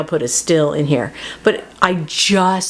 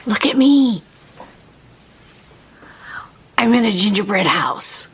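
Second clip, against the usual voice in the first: put-on kid voice; 3 semitones higher than usual. Child in story, playful whisper.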